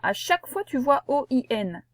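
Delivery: ordinary talk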